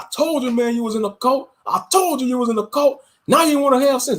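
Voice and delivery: mock him like a smug relative; imitating mockery. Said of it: "Then they might start mocking you".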